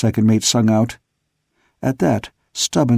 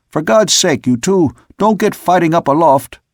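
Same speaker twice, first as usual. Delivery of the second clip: urgent call; 5 semitones higher, 4 dB louder than usual. A narrator's reading. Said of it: "I sung out".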